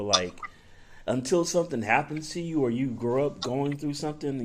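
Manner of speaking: normal talking voice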